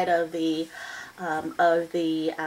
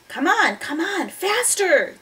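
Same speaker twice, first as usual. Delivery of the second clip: high, pressing command; urgent order; 9 semitones higher, 3 dB louder than usual. A narrator's reading. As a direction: urge the machine on as Jack. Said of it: "Jack ordered the mini sub".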